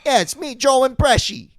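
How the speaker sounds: high hammed-up Pesci voice; Joe Pesci impression. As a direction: announce yourself as a bad Pesci. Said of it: "I never sound like the guy that I'm trying to do".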